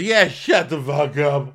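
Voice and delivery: loud, high, drawn-out mock yell; mock drunken shout. Drunk guest snapping at laughing crew, mock shout. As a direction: imitate a slow belligerent holler.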